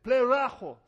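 "Pleraho" said loud and clear, stressed on the first syllable; pronouncing Greek. Shown as a demonstration. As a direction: pronounce "Pleraho" slowly for the congregation to repeat. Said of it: "can you say the word pleraho?".

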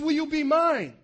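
God's question called out high and loud; loud proposal. Acted. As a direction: proclaim it like a groom before everyone.

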